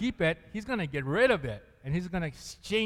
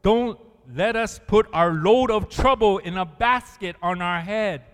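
loud, earnest prayer voice; reading a prayer aloud; 5 semitones higher, 8 dB louder than usual. Acted. Preacher reading the basket prayer aloud, loud and earnest. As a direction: proclaim the prayer with a smile. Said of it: "the prayer said".

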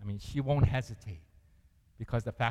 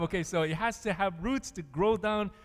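normal talking voice

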